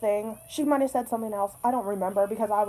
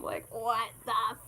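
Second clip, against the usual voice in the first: stunned disbelief; much slower than usual. Real, not acted. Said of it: "reacting in disbelief".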